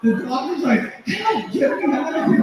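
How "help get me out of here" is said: high-pitched mock plea from a frightened dog; scared dog. Host voicing a scared Pomeranian in the audience, high mock fear. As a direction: play a terrified little dog, pitched up. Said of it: "This poor dog is scared".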